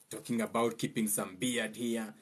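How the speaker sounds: ordinary talk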